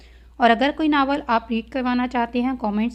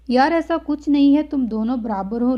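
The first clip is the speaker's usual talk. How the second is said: fed up; 2 semitones higher, 5 dB louder than usual. A narrator's reading. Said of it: "Laiba उकता कर बोली".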